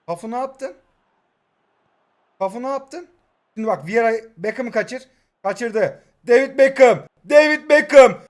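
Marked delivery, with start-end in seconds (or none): 6.3-8.2 very loud, high name call; excited commentator shout. Real, not acted. Player celebrates Beckham's move, shouting excitedly.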